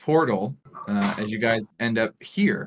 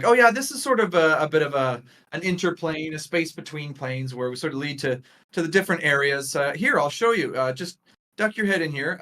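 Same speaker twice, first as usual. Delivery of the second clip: higher-pitched, easygoing guide voice; casual tour guide; 6 semitones higher, 4 dB louder than usual. Acted. Guide showing visitors the space between planes, casual. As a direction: show newcomers around, casually, pitched up. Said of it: "she's just casually walking through and be like".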